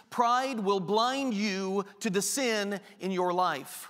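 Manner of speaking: normal talking voice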